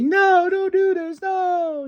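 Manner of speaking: a hammed-up tearful protest; mock crying plea. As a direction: beg him to stop through fake tears. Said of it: "[name]'s crying".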